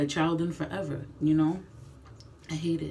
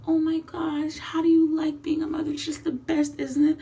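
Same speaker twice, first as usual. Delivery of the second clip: much higher, louder, bubbly mock gush; gushing acquaintance; 12 semitones higher, 6 dB louder than usual. Mimicking a gushing friend asking a mom about motherhood, high and loud. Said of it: "they're all happy and gushing".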